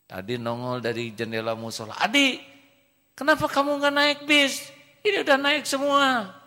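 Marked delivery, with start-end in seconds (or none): 2.0-6.3 high, loud, falsetto teacher calling. Acted. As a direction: call him out from the bus, high and loud. Teacher scolds boy at bus. (suara guru tinggi dan keras)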